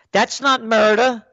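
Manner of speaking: high, indignant mimicked protest; put-on voice of objection; mock defender. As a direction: parody someone excusing their politician.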